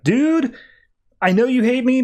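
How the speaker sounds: plead, fed up; exasperated plea. Retold or imitated. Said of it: "Superman's like".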